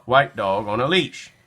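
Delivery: ordinary delivery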